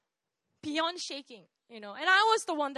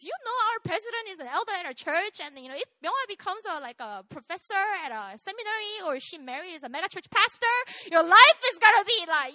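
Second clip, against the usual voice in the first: higher, building to a loud promise; imitated emphatic boasting; 5 semitones higher, 9 dB louder than usual. Uncle selling church's power to parents, imitated emphatic boasting. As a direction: talk them round like an impressed big shot.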